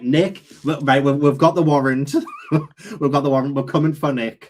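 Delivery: playful police raid, laughing; laughing mock arrest of Nick; mock detective. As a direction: announce the warrant like a cop in a TV show. Playing detectives with a warrant.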